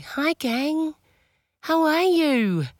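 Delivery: hoarse from being ill; croaky, under the weather; sick voice. Real, not acted. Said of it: "I'm really trying not to be in my sick voice".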